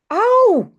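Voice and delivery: put-on a child's burnt-finger ouch; cry of pain. A narrator's reading. Jip burning his hand on the stove, high pained yelp. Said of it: "gilt hij".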